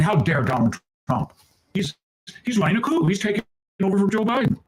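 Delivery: high, indignant mock outrage. News hosts feigning outrage at Trump, high mock indignation. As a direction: squeal it like fake-shocked pundits. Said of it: "They should be saying".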